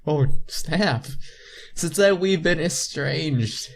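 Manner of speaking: usual speaking voice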